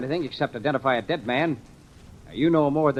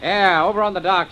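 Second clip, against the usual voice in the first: calling out; 7 semitones higher, 7 dB louder than usual. Acted. Calling out across the dock.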